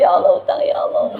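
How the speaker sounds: laughing, rising into a high mock wail; mock wailing lament. Preacher mocks a self-pitying wail, high and laughing. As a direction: ham up a tearful complaint to God about debts and kids.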